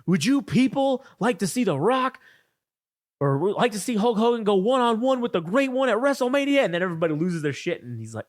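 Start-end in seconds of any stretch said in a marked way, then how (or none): 0.1-6.6 hyping the crowd. Retold or imitated. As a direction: work the crowd like a wrestler on the mic. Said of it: "So then he's like".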